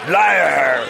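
loud accusing shout from the crowd. A narrator's reading. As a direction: hurl it across the hall. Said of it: "That was a shout from a deep-voiced representative".

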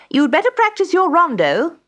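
high, loud teacher voice; instructing music teacher. A narrator's reading. Music teacher on the day before the concert, instructing. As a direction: tell the pupil firmly, high and loud.

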